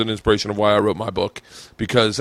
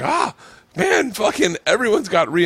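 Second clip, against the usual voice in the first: high-pitched, animated outburst; animated self-quote, higher than usual; excited reaction; 9 semitones higher than usual. Retold or imitated. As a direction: act out being thrilled, pitched up. Acts out his excited reaction.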